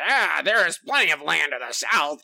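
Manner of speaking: lightly voiced scheming villain; a hint of a sneering villain; mustache twirling villain. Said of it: "I can hear his voice".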